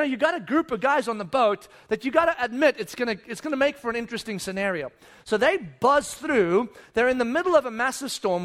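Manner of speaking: usual speaking voice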